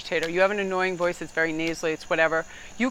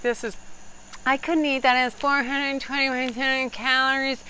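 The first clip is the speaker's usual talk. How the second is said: whiny diner; 7 semitones higher than usual. Retold or imitated. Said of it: "that voice like that ... nagging, whining".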